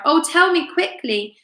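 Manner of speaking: high, hurried plea; urgent. A narrator's reading. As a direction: beg him to hurry. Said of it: "she cried".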